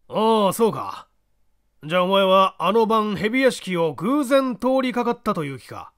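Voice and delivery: put-on dry probing old man; creaky wise old man. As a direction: press him calmly. Small man probes.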